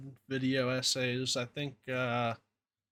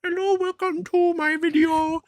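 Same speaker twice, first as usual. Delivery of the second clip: put-on much higher YouTuber kid greeting; Indian kid voice; 4 dB louder than usual. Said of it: "like, some Indian kid".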